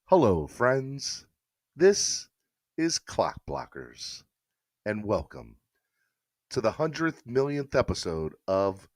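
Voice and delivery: slow, hushed golf broadcaster impression; Jim Nantz. Welcoming viewers to the Masters, slow Jim Nantz impression. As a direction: drop low and slow, pausing between phrases like a TV host. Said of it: "here's my".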